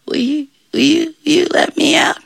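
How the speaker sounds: high, halting little voice; meek pleading. Acted. Devil stuck in the bush asks release, meekly.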